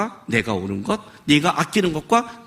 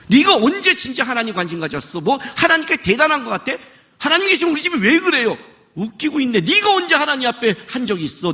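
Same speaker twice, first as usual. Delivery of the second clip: higher, pressing scolding questions; indignant rebuke; 6 semitones higher than usual. Acted. God rebuking a self-righteous believer, indignant higher scolding. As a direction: scold the proud believer, pitch pushed up.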